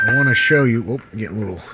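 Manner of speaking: usual speaking voice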